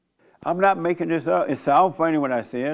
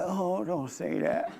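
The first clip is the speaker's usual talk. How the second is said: imitating a serious, hushed objection; softly, slowly protest, no joking; retold earnest protest, drawn out and soft; real serious; 3 semitones higher, 9 dB quieter, slower than usual. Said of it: "real serious. He wouldn't laugh".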